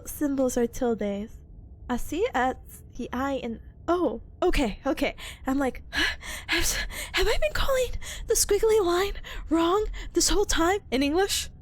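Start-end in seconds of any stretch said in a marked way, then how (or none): 5.9-6.4 sharp, unvoiced gasp; shocked gasp. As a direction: gasp in sudden realization. Mock shock at being wrong.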